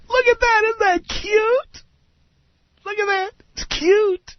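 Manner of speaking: high falsetto, drawn-out cooing; mock a gushing shopper in a squeaky high voice; window-shopping woman. Man mimicking a woman cooing at store windows, high mocking falsetto. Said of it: "woman who looks at store windows all the time and says".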